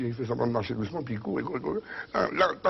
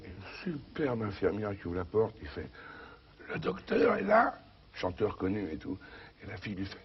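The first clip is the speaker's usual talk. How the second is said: ordinary delivery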